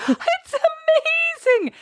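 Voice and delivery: very high, slow, drawn-out gasp of wonder; hammed-up childlike amazement; mock awe. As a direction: squeal it out in delight. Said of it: "I'm like".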